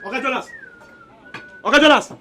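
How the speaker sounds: shouting a name across the yard; calling out. Acted. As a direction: call him loudly from afar. Calling out to the boss.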